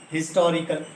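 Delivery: ordinary delivery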